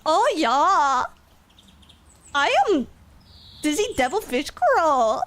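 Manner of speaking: sing-song mock Swedish accent with swooping pitch. Shown as a demonstration. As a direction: ham up a bouncy Swedish accent. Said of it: "let me do my best, best, like, Swedish accent".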